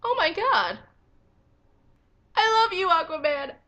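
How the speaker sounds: high, loud squealing delight; gushing fangirl. Real, not acted. Fan reacting to Aquaman's retort, loud gushing joy. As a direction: burst out in adoring excitement.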